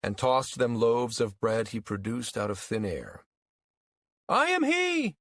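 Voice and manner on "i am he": high, booming proclamation; messianic cry. A narrator's reading. False Christ to the crowd from a truck, crying out. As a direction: proclaim yourself to the masses, very high. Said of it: "he cried".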